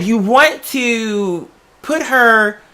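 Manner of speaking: ordinary delivery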